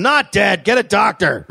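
mock protest from the injured secretary; wounded man's retort. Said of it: "spat out some blood and said".

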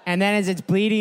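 ordinary talk, nothing marked

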